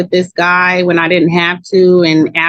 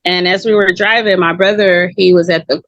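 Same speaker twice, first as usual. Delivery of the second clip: ordinary talk, nothing marked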